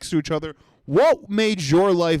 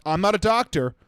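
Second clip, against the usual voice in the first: ordinary talk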